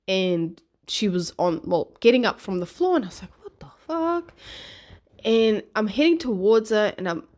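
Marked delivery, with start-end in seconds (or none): 3.4-4.3 high, exasperated retold outburst; frustrated disbelief. Mum to fallen daughter on the run, frustrated disbelief. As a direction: pitch jumps up in irritated disbelief. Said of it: "like, frustrated. I was a little bit irritated".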